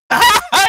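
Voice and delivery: sudden loud, high-pitched laugh. Real, not acted. Laughs at the retold story.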